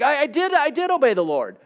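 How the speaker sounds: a hammed-up king insisting he obeyed; Saul gaslighting. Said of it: "He's like".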